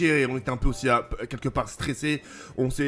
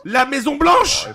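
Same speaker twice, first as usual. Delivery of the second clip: high, loud, drawn-out cry of disbelief; excited shout; 12 semitones higher, 9 dB louder, much slower than usual. Pretend. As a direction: yell it slowly as an astonished question. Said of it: "Mais pourquoi il crie?".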